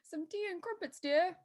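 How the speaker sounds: mock posh English accent, pitched high and soft; bad English accent. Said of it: "That's a bad accent".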